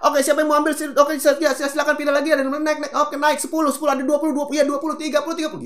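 high, loud rapid-fire bidding call; auctioneer patter. Retold or imitated. Teacher imitating an auction MC calling bids, fast and loud. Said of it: "MC lelang itu kalau ngomong cepet banget".

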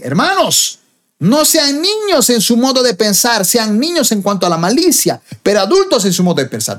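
high, emphatic address to the congregation; exhorting scripture reading. Acted. Preacher reads Corinthians to the brethren, high emphatic exhortation. Proclaims a Bible verse.

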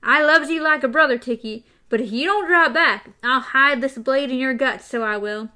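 loud panting threat; knife threat. A narrator's reading. Gasher warning TikTok off, loud threat. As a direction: warn him off, breathless and loud.

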